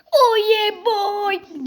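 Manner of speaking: drawn out, very slow and smug; slow catchphrase. Pretend. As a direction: stretch the catchphrase out like a proud show-off. Catchphrase after passing obstacle. (yavaş, keyifli nara)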